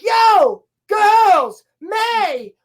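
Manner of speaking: loud, high shouted names; urgent mock hollering; imitated calling out. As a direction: holler for the girls one by one. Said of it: "And he's like".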